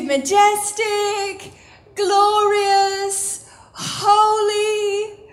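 high, held-out, ringing words; exalting proclamation. Acted. Preacher tells believers what God calls them, exalting. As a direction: proclaim each title slowly, almost sung.

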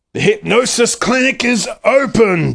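high gleeful villain call; villain yell. Acted. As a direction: crow it like a cartoon baddie. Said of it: "Doctor Dirt yelled".

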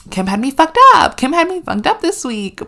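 loud, high outburst of disbelief; sudden loud outrage. Real, not acted. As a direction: blurt it out, stunned and loud.

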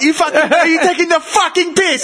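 high, indignant mock Cockney; Cockney accent. Friend imitating a Londoner to mates, indignant Cockney accent. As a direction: challenge him in a put-on Cockney accent. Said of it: "they've got the Cockney".

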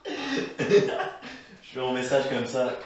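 slow, laughing through words; struggling not to laugh. Real, not acted. Laughing while talking.

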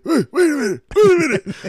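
very high, loud yelps for them to wait; hammed-up frantic yelling; panicked mock shouting. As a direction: holler like someone running for a closing gate. Acts friend running for the plane.

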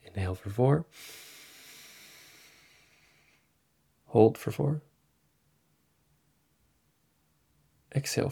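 soft, fading breathing cues. Shown as a demonstration. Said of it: "Let's try that together".

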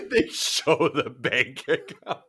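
laughing it out, slow and high; words bubbling with laughter. Real, not acted. Laughing at friend's twist idea.

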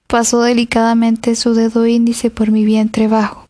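ordinary delivery, nothing marked

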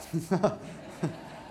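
short, soft laugh; amused chuckle. Real, not acted. Preacher amused by the read-aloud Bible quip, chuckling. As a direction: chuckle at your own quoted joke. Laughs at his quoted joke.

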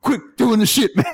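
high, crazy frontman voice; hammed-up, high-pitched crowd scolding. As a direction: imitate a wild-voiced singer telling off a kid. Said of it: "he's got the crazy voice".

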